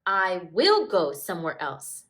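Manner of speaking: loud defiant outburst; crying out. Acted. Smudge storming out yells at Stripe. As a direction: shout it at Stripe as you storm off. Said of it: "Smudge cried".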